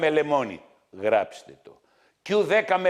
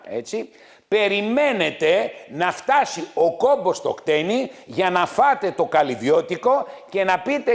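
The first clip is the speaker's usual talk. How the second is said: ordinary delivery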